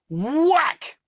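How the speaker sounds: high mock whack; whack sound. Retelling the otter scene, a high mock whack. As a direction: smack it out like a blow. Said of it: "And he gets this thing and goes".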